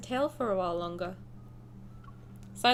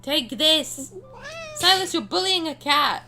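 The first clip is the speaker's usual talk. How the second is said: louder, higher playful battle cry; playful shout; 7 semitones higher, 6 dB louder than usual. Real, not acted. Streamer playing the kitten fighting Silas, gleeful louder shout. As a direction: egg the cat on with glee.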